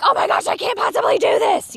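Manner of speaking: high, hammed-up panicked gardener; mock panic. Imitating an overwhelmed gardener freaking out, mock panic.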